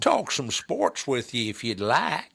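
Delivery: a light, slow mock Southern accent. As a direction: offer it with a lazy Southern drawl. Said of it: "he's probably got a Southern accent".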